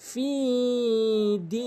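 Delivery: ফি and দি each held on a long ee; drawn-out vowels. Shown as a demonstration. Teacher shows how to stretch ফি দি, drawn-out vowels. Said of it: "এভাবে টেনে পড়েন".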